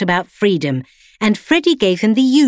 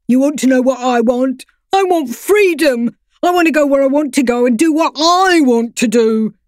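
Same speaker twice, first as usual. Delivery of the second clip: higher, louder put-on voice of a defiant dog; rebellious dog; 8 semitones higher, 4 dB louder than usual. A narrator's reading. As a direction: demand freedom with stubborn fire. Freddy character voice.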